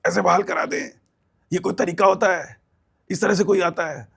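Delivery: mock the official's annoyed brush-off; retold angry rebuke. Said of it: "वो जो गुस्सा".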